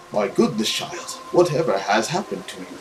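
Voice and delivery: low, soft gentle priest; a hint of a kindly old priest; lightly voiced priest. Priest greeting the soaked girl, low and soft.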